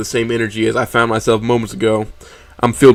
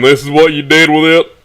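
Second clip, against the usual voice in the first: higher, louder accusing question; scornful reproach; 5 semitones higher, 5 dB louder than usual. Acted. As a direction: throw it out as an accusation. Acted scornful reproach in story.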